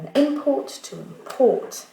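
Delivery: an import with IM stressed, then to import with PORT stressed; said slowly, high and loud; noun and verb stress contrast. Shown as a demonstration. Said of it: "le schéma accentuel".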